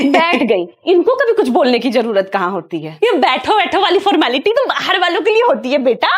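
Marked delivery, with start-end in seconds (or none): 0.1-3.0 low-pitched grumble about a guest; annoyed aside. Acted. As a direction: drop the pitch, grumbling to yourself. Aside about the uninvited neighbour. (नीचे सुर में खीझकर अपने आप से)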